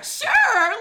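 very loud, high mock agreement; breezy acceptance blown out of proportion; overly casual yes. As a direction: laughingly belt out an overeager yes. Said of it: "Oh, you wanna get ice cream?".

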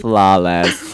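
loud, low, stretched verdict; hammed-up low proclamation; emphatic game answer. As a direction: draw the word out heavily. Playing the one-word answer game.

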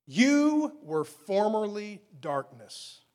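slow, loud, every word of You were formerly darkness leaning heavy; weighty scripture reading. Shown as a demonstration. Preacher reading Ephesians to the church, slow and weighty. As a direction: read it slowly and let each word land. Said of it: "realize the weight behind them".

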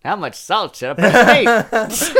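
high, slow, formal reading; transatlantic accent. Acted. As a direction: read it like an old film announcer. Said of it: "you need a little bit more speed and a little bit higher pitched".

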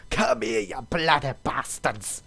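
hammed-up Scottish dwarf bellow, drawn out; mock Scottish roar charging into battle; Scottish battle cry. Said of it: "as bloody Scottish as I could make".

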